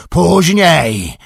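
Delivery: "Później!" shouted high and loud; shouting. A narrator's reading. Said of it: "podnosząc głos do krzyku". (wykrzyczane głośno i wysoko "Później!")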